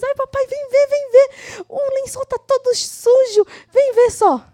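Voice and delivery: high, loud excited call to dad; excited child calling. Acted. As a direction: call out to papa, thrilled with yourself. (menino todo feliz chamando o papai, mais agudo e alto)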